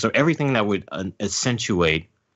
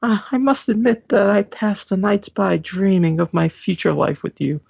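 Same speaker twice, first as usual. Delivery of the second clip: put-on high, dreamy old man voice, much higher than usual; lovestruck old man; 14 semitones higher, 4 dB louder than usual. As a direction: swoon like a smitten old geezer.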